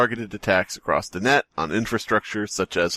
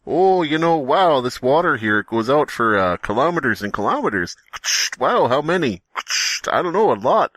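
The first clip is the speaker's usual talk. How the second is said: goofy Dave voice; 5 semitones higher than usual. Acted. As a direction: gush about the endless water. Voicing Dave at sea.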